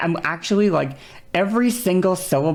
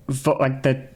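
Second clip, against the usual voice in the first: usual speaking voice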